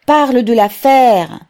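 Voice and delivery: high, loud demand; rough heckling cry. A narrator's reading. A rough voice by the mother interrupts Pavel, shouting loudly. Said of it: "s'écria une voix rude".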